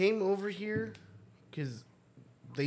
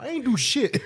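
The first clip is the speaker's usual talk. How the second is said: mock Tyler reply; 8 semitones higher than usual. Voicing [name].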